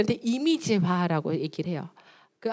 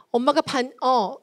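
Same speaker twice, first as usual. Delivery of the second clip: high, louder scolding; angry mother; 5 semitones higher, 5 dB louder than usual. Acted. Mother scolding her child not to make excuses, high and loud. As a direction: cut the child's excuse short. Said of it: "이렇게 얘기를 했어요".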